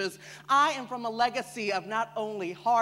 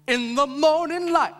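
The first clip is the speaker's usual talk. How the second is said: high, loud church preaching; fervent preacher; 7 semitones higher, 8 dB louder than usual. Acted. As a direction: preach it in bursts, stretching the big words.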